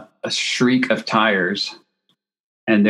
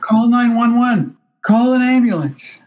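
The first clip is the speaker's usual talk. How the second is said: imitating her panicked call for help, very high and frantic; mock hysterical plea; 4 dB louder than usual. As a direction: wail it out like a panicked model. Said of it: "Like this".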